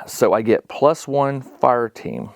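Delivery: ordinary talk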